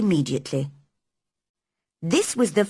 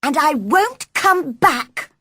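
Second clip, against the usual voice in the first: put-on slow, louder, huffy girl's threat; defiant girl's shout; 9 semitones higher, 4 dB louder, slower than usual. Mary leaving the sick boy in a temper. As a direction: stamp each word as you storm out. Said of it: "Mary shouted in reply".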